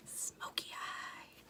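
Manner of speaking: slow hushed whisper of dread; dramatic whisper. Acted. Confessing to viewers her worst skill, whispered slowly. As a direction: whisper it like a shameful secret.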